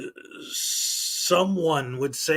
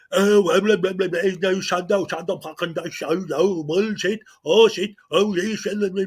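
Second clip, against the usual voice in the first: louder sputtering babble, a hint of mocking; mock ranting auditor; 2 semitones higher, 4 dB louder than usual. Mocking the auditor yelling at church people. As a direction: gabble it like a tantrum. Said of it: "He pulls the".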